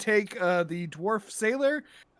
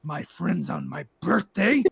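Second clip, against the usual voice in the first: psychotically mad; 4 semitones lower, slower than usual. Acted. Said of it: "psychotically mad".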